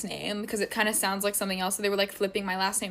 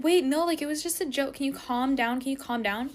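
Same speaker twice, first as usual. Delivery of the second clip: higher-pitched, pleading mock voice; acted-out placating friend; imitated backpedalling friend; 4 semitones higher than usual. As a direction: mimic her hurriedly trying to smooth it over. Imitates friend backpedalling after block.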